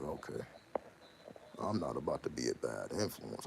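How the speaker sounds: low, soft inner thought; quiet resolve. Retold or imitated. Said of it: "I'm like".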